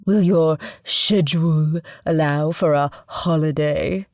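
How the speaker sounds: a pretentious put-on British accent; mock British accent. Narrator mocking her father's affected British accent. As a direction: imitate father's fake British accent, lightly. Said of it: "a special British twist to his speech patterns and pronunciation".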